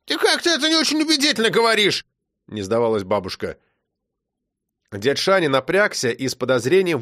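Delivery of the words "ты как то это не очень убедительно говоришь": put-on high, fast old woman; grandma refusing to give up; insistent grandma. A narrator's reading. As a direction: press her point quickly.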